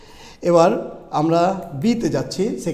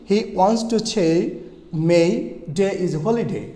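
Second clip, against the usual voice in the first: word by word, stretching "say" and "May"; very slow, deliberate model reading; slow practice sentence; much slower than usual. Shown as a demonstration. Teacher repeats the practice sentence for learners, slow and separated. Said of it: "আবার বলছি".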